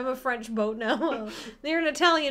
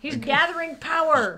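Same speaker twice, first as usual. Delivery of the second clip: lightly hammed villainous announcement; mock-grand declaration; 3 semitones higher, 3 dB louder than usual. Co-host joking about absent Alex, mock-grand.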